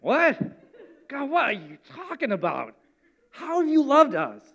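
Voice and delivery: hammed-up sassy retort in separate bursts; mock indignant back-talk, arms crossed; rude, stink-faced attitude. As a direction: play it with a big stink face. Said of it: "put a stink face on and said".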